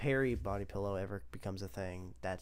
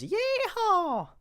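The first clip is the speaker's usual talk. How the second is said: high, loud yeehaw holler; whooping rodeo yell; imitated cowboy yeehaw; 8 dB louder than usual. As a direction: belt out a high-pitched whoop. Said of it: "when it goes".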